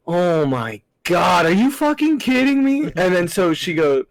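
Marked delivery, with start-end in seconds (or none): 0.1-2.8 joking disbelief. Retold or imitated. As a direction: protest it in jest, rising sharply. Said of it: "I jokingly was like".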